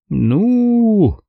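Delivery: высокое протяжное «ну» с робостью; замнись, протяни высоким голосом; робко. A narrator's reading. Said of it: "робко посмотрел на брата Шейн". (робкая заминка повыше обычного голоса)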